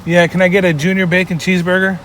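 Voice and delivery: usual speaking voice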